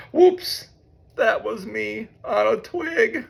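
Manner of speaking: high squeaky mole, not whispered. A narrator's reading. Mole apologizes for stepping on a twig, high and squeaky. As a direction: oops in a tiny high voice. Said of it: "whispered Mole".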